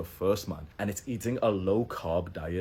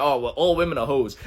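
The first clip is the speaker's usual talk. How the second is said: mock bro voice; 5 semitones higher, 7 dB louder than usual. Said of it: "for guys to say, like".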